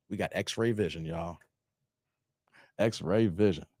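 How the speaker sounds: soft low aside to the chat; hushed aside. Real, not acted. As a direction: drop to a low knowing murmur.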